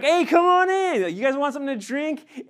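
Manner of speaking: loud, high, cheerful greeting at the door; imitating a host happily calling family in; pleasant welcome. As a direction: throw the door open for family. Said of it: "you welcome them in pleasantly".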